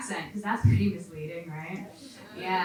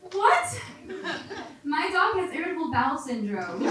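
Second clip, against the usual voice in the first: squeaky, high incredulous what; 7 semitones higher than usual. Real, not acted. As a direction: act stunned. Incredulous reaction.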